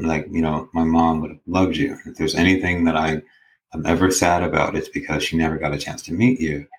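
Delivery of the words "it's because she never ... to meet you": normal talking voice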